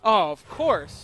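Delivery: loud, slow, drawn-out of course; exaggerated of course. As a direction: stretch it out, playfully offended. Mock offense at Ragnaros question.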